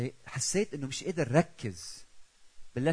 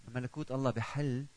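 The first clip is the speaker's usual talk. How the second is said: ordinary talk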